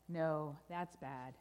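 low, slow contradiction; ominous correction. Acted. Storyteller overturns the good news, low and grave. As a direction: let the bad news sink in, slowly.

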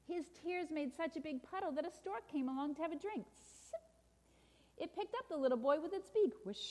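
animated storybook narration; children's story reading. A narrator's reading. Preacher reads a picture book to listeners, animated storytelling. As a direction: read it to children with lively emphasis.

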